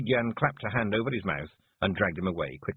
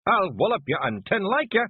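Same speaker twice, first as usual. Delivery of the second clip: higher, louder threat; yelling; 13 semitones higher, 6 dB louder than usual. A narrator's reading. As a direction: square up and roar it. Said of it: "yelled Rob Anybody".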